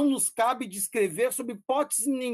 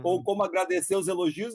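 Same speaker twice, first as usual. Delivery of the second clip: ordinary talk, nothing marked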